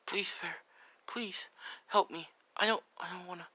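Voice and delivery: high, soft mock-tearful plea; tearful pleading. Boy begs the hidden figure for help, acted tearful pleading. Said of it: "he's crying, tears of".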